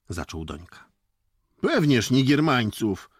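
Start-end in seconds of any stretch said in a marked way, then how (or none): 1.6-3.1 raised voice, mock-stern village boy; feigned gruff severity. A narrator's reading. Jakub answers Dońka about the soldiers, with feigned sternness. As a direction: grumble with put-on severity, voice up. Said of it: "burknął Jakub z udaną surowością".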